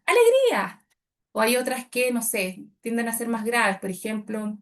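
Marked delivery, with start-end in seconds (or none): 0.1-0.8 alegría shouted high and bright with joy; joyful burst. Shown as a demonstration. Coach showing joy in the word, high and loud. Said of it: "si yo digo alegría".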